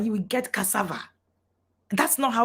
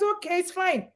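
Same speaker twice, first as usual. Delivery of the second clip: lightly voiced child, higher pitch; child giving in; 8 semitones higher than usual. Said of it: "the child says".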